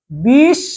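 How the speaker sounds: বিশ with a long held sh; first syllable "bish", drawn out on the sh; split syllable demo. Shown as a demonstration. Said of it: "উচ্চারণটা কিভাবে করি দেখো".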